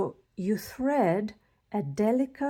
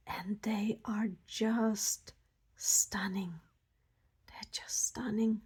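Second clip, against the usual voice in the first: soft whisper, much softer; awed whisper; 6 dB quieter, slower than usual. Real, not acted. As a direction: hush it like a secret. Awed by crystals, hushed.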